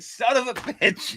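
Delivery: high, drawn-out mock cry; retold exasperated curse. Barber customer recalls cursing on the drive back, high drawn-out exasperation.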